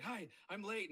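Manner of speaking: high, loud mock greeting; breathless-style late greeting. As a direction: call out a flustered arrival. Acts out arriving late, soaked.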